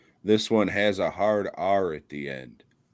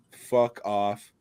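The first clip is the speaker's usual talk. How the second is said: ordinary talk, nothing marked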